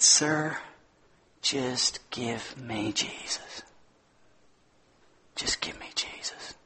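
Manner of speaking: hushed, slow whispered plea; tender whisper. Retold or imitated. Grieving woman begging the gardener, whispered tenderly. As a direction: breathe it out as a soft plea. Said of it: "so beautiful the way she said it".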